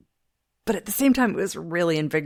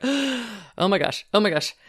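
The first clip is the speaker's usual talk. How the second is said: breathless, higher oh my gosh; panicked gasp; 4 semitones higher than usual. Retold or imitated. Host reliving her first camera offer, panicked.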